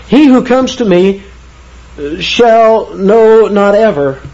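"shall know not ever" slowed right down, each word stressed and high; emphatic. Shown as a demonstration. Preacher showing the congregation the original's double negative, emphatic. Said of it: "this is so emphatic".

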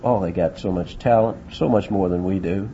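low, understated inner thought; coach's private doubt. Acted. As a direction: voice a coach's unspoken worry, lightly. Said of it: "in the back of his mind".

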